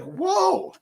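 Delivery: high, mock-amazed whoa; hammed-up wide-eyed whoa. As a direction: act stunned at the bank printout. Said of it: "I was like".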